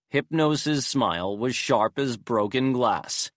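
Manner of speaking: ordinary delivery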